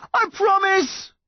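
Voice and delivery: high, slow strained yell; yelling back. A narrator's reading. Son holding his mum's hands, yelling back. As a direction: stretch it into a desperate yell. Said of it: "Connor yelled back".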